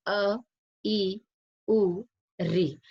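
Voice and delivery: अ, ई, उ, री each said briefly, with pauses between; short vowels. Shown as a demonstration. Teacher showing pupils quick vowels, short vowels. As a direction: say अ, ई, उ, री as separate short sounds. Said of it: "बहुत कम समय लगता है".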